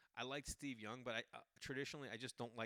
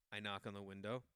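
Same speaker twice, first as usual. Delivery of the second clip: ordinary talk, nothing marked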